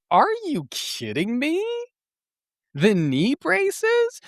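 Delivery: stunned that anyone would ask that; incredulous outrage. Retold or imitated. Indignant reply to the guy.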